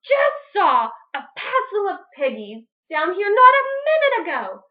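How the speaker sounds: high, loud demand; bully the duck; screeching witch. Acted. Witch Grinch demanding the hogs, high and loud. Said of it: "Grinch screeched".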